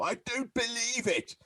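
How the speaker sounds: high-pitched sitcom catchphrase impression; mock disbelief in a put-on high voice; Victor Meldrew. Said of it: "the guy that played Victor Meldrew".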